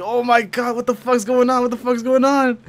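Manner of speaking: high, frantic cry of alarm; panicked. Retold or imitated. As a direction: shout it out in rising panic. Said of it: "I was like".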